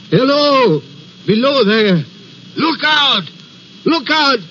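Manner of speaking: high warning calls, held back in volume; imitated hoarse cry. Signalman retelling the figure's warning, a hoarse cry. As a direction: cry a warning without full shouting. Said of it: "the voice was hoarse with shouting and it cried".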